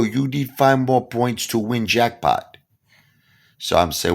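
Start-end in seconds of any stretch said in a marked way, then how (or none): 0.0-2.6 casino hustler. Retold or imitated. Pablo explaining jackpot.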